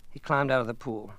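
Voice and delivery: plain reading voice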